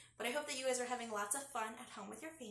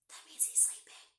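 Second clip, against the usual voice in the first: hushed whisper; 8 dB quieter than usual. Real, not acted. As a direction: whisper so you won't wake him. Aside to children about sleeping turtle.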